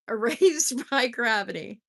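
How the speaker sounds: corrected title through a laugh; laughing correction. Shown as a demonstration. Reader fixing her misread title, laughing. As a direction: laugh while saying it right. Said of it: "Did I say gratitude?".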